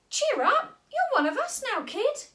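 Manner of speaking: buoy her spirits; cheering up. Acted. Astronaut comforts Molly, loud and encouraging.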